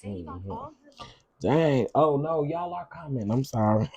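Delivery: ordinary talk, nothing marked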